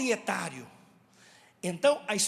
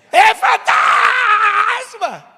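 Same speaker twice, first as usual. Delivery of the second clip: very high, loud, long-held shriek; full-blast cry of fright; terrified scream; 11 semitones higher, 15 dB louder, much slower than usual. Shown as a demonstration. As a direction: scream it with all your might, stretching the vowel. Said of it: "como que você gritaria?".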